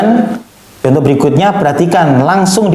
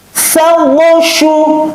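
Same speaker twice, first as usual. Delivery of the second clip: high, crisp Quran letters; fast, firm recitation; 13 semitones higher, much slower than usual. Shown as a demonstration. Teacher shows pupils fast, firm letter reading, high and crisp. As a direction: snap out each syllable firmly. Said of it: "tegas, dan benar".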